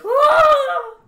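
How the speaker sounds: high loud shrieking death cry. Retold or imitated. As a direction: scream like falling to death. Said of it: "like, a death scream".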